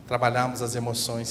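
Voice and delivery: ordinary talk, nothing marked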